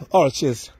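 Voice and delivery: ordinary talk, nothing marked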